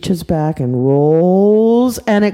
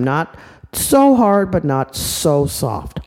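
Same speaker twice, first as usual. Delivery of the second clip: slow patient mom; 2 semitones lower, slower than usual. Acted. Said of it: "She goes".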